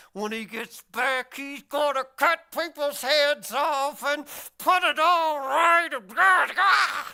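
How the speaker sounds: mock bloodthirsty rant. Imitating commenters who want heads cut off, bloodthirsty. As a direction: build from tense and slow to a shouted growl. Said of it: "I can feel their teeth".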